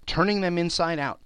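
usual speaking voice

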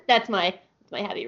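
ordinary talk, nothing marked

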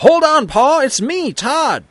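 high-pitched urgent shout; calling out. A narrator's reading. Son rides up in the dark to father, shouting out. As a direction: shout to stop someone firing. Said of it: "The figure shouts out".

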